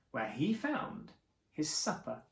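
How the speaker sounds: usual speaking voice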